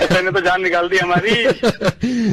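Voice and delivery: slow, relieved laughing words; relieved victim laughing at the prank. Real, not acted.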